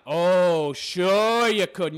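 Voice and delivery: loud, drawn-out mock agreement; sarcastic disbelief. Real, not acted. Commentator answering her claim of not caring, sarcastic. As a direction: stretch it slowly, dripping with sarcasm.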